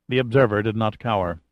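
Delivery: ordinary delivery, nothing marked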